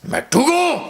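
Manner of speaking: high, strangled mock cry; strained outcry. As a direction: cry out a name in hammed-up pepper agony. Burning man cries out a name.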